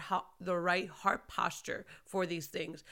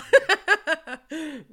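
amused laugh; 8 semitones higher, 11 dB louder than usual. Real, not acted. Laughs after her road rage prayer.

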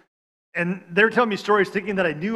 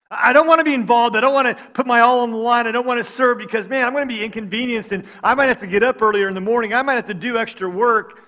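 whiny reluctant excuse; 5 semitones higher, 7 dB louder than usual. Acted. Voicing a reluctant believer.

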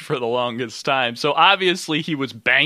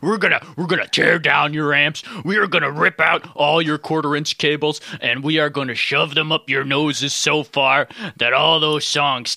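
wrestler promo; 3 semitones higher than usual. Pretend. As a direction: cut a hammy wrestling heel promo. Mock wrestler promo threat.

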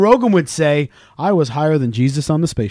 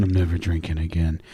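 hungover mumble; 11 semitones lower, 7 dB quieter than usual. Retold or imitated. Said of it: "wake up the next day going".

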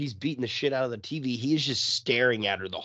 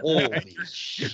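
imitating a panicked man; 4 semitones higher, much slower than usual. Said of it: "on the verge of a fucking nervous breakdown".

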